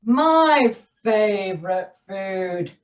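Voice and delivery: slow, looming monster voice; Gruffalo voice. Acted. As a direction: savour the words like a hungry beast. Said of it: "the Gruffalo said".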